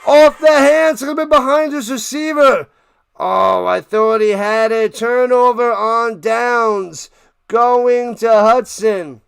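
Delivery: loud, high-pitched live football call; excited play-by-play. Real, not acted. Commentator calling a slant over the middle, excited shouting. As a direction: build to a shouted peak, then draw out the letdown.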